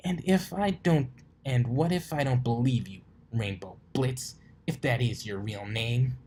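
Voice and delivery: high start, sneering; challenging. Acted. Dash doubting his name, sneering challenge. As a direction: dare him to lie.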